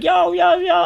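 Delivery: very high, loud repeated holler; retold shout. Teller yells back at the potato thrower, high retold shout. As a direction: holler back at full pitch.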